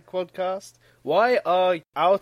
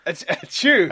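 louder acted achoo sneeze; fake sneeze; 7 dB louder than usual. Shown as a demonstration. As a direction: spell out a sneeze, loudly. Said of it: "I'm saying the sneeze".

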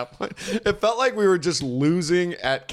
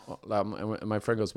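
usual speaking voice

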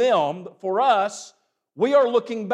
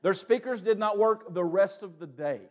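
normal talking voice

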